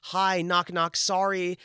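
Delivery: high, self-effacing greeting; apologetic entrance. Acted. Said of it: "That's how I walk into rooms".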